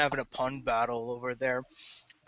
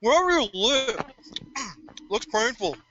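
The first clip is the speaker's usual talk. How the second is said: alien character voice; 7 semitones higher than usual. Acted.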